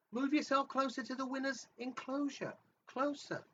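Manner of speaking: high soft eerie beckoning. Acted. Eerie voice game card.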